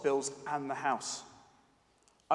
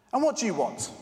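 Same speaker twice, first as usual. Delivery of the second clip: sharp, louder annoyed pharmacist; 5 semitones higher, 4 dB louder than usual. Acted. Pharmacist brushing off an eight-year-old, annoyed. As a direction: snap at the little girl, irritated. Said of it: "in an annoyed tone of voice".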